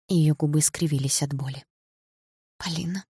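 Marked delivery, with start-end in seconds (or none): 2.6-3.1 soft, fragile call of a name; whispering. A narrator's reading. Tearful Sasha addressing Polina, whispering. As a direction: say it softly, nearly whispered. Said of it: "прошептала Саша".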